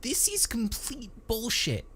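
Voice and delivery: high-pitched whiny complainer. A narrator's reading. Whiny techie protesting to the barracks, whining. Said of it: "Tink's inherently whiny voice".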